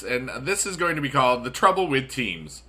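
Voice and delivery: ordinary talk, nothing marked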